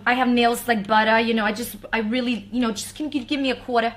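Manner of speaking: put-on Brooklyn accent, tossed off lightly. Singer showing off accents to her host, Brooklyn accent. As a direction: play a Brooklyn local. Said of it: "that's, like, the Brooklyn accent".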